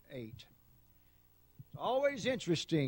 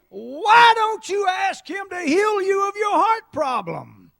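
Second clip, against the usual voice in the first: high, loud, slow inner voice; preacher shouting the thought that came to him; preaching shout; 14 semitones higher, 13 dB louder, slower than usual. Acted. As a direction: call it out to the whole church.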